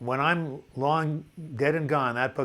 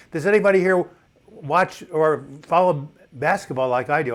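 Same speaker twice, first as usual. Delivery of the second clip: louder and higher, thrilled with the news; excited reveal; 3 semitones higher, 6 dB louder than usual. Real, not acted. Host revealing the Raptors news to a viewer, loud and excited. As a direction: announce it like big sports news.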